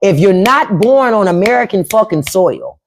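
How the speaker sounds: ordinary delivery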